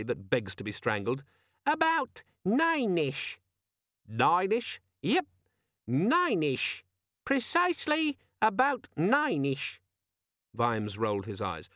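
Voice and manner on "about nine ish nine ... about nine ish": very high, slow, squeaky demon voice; smug little gadget, drawn out; squeaky, friendly. A narrator's reading. Organizer demon smugly giving Vimes the time, squeaky. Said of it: "which had the squeaky, friendly quality".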